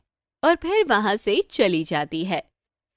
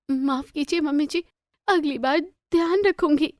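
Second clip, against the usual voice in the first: higher, timid daughter-in-law apology; meek apology; 6 semitones higher than usual. A narrator's reading. Bride apologising to mother-in-law, timid.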